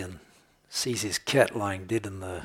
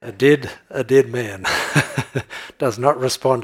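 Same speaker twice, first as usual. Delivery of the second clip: laughing; 3 semitones higher, 8 dB louder than usual. Real, not acted. Still laughing after joke.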